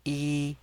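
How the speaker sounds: yi on a high, level tone; first tone. Shown as a demonstration. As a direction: hold "yi" high and flat. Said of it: "is the first tone".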